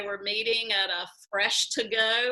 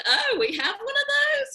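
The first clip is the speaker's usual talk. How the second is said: surprised and much higher, a bit louder; 5 dB louder than usual. Retold or imitated. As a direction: pop up high in disbelief. Learning the coalition already exists.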